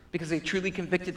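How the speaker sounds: usual speaking voice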